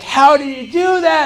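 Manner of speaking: high, loud, slow astonished question; amazed kids. Retold or imitated. Classmates stunned by the solved problem, high amazed voice. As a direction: gasp it out in disbelief. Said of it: "all the kids were like".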